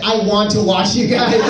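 ordinary talk

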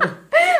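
loud, high burst of laughter; laughing. Real, not acted. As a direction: burst out laughing at your own joke. Joking about bathing in sanitizer. (ज़ोर की, ऊँची हँसी)

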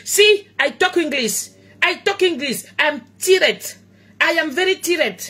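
mock-proud broken English in a French accent. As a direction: show off her English with a heavy French accent. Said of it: "Tata Doudou ne parle pas anglais".

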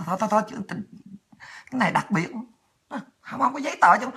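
soft, hasty waving-off; hushed backing down. Retold or imitated. Airport guard backs down, waves her through.

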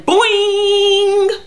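high, loud springy sound effect; cartoon boing. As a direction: let it ring out like a cartoon spring. Imitates the rat's springing leap.

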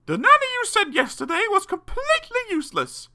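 very high-pitched, indignant mum; hammed-up high mother's voice; complaining mother. A narrator's reading.

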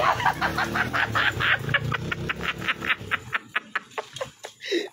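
breathy giggle. Real, not acted. Husband flees the camera.

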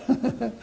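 put-on a light high giggle for the old man; mock old man's giggle; high imitated chuckle. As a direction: imitate his happy laugh, high and soft.

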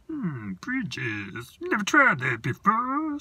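high, loud, slow creature king; Snoswatch King voice. Acted. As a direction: announce yourself grandly in a high creature voice. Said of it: "said the king".

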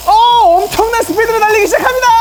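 fast, high, thrilled race call; excited commentary. Real, not acted. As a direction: rattle it off like live play-by-play.

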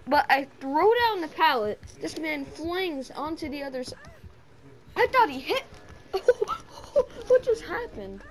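yelling, can't believe it; excited disbelief. Real, not acted. Retelling a crazy play.

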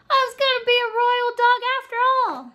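high, loud and fast; overjoyed little dog; joyful. Acted. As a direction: blurt it out in delight. Dog's joy at becoming royal.

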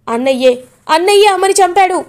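angry accusation. Acted. Rishi accuses his brother.

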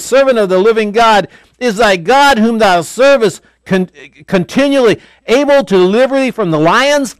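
high, loud, pleading call; anxious call. Retold or imitated. The king calls into the lions' den, high loud anxious cry. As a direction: call down into the pit, afraid of the answer.

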